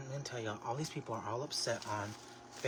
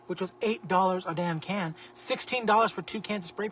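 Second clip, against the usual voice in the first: louder, higher indignation at the price; indignant; 6 semitones higher, 8 dB louder than usual. Real, not acted. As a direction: complain loudly about the cost. Outrage at spray paint price.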